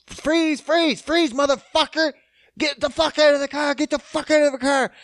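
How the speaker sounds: high, loud retold cop shouting; cops yelling commands. Retelling cops shouting at the driver, loud yell. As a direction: bark orders like police at a car. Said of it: "They were trying to tell him".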